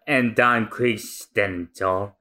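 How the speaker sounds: usual speaking voice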